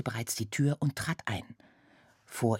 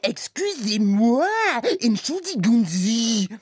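higher, loud, slow French apology; maid's apology; 10 semitones higher, 8 dB louder than usual. Retold or imitated. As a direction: imitate the clumsy maid apologising. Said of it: "hat sie gesagt".

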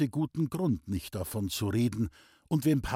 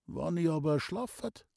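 coaxing patient; 5 semitones higher than usual. A narrator's reading. Sick man coaxing from bed.

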